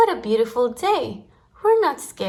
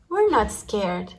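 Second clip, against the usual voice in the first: ordinary delivery, nothing marked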